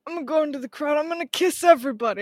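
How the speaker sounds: a hint of brash Trump; Trump impression. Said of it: "I don't have a Trump impression".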